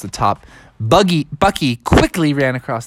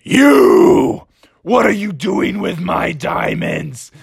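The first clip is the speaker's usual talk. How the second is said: raised, strained monster voice; drawn-out dragon outrage; angry dragon; 4 semitones higher, slower than usual. Acted. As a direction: confront the thief as a dragon. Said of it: "he's like".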